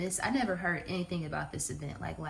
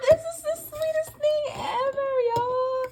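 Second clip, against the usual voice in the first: delighted laughing; 5 dB louder than usual. Real, not acted. Delighted by gift.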